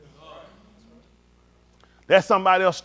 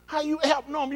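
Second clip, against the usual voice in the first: ordinary talk, nothing marked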